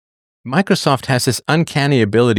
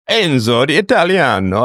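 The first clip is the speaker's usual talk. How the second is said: exaggerated, higher-pitched cartoon Italian; swooping, over-the-top Italian accent; outrageous Italian accent; 4 semitones higher than usual. Shown as a demonstration. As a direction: ham it up with a wildly inaccurate Italian accent. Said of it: "I just did outrageous Italian accent".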